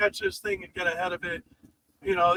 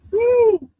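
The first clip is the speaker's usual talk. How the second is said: excited whoop; 14 semitones higher, 8 dB louder than usual. Real, not acted. Cheering at the storm.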